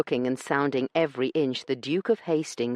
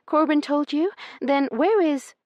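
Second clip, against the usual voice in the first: hopeful; 13 semitones higher, 5 dB louder than usual. A narrator's reading. Hope leaping in her chest.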